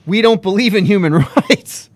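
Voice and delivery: quoting through a laugh; scoffing laugh on the quote; laughing mock quote. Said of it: "China says pretty much in their press release".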